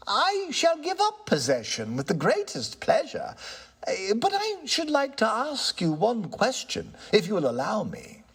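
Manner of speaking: polite, regaining his nerve; lightly voiced tenant. Tenant answers the ghost, calm and courteous.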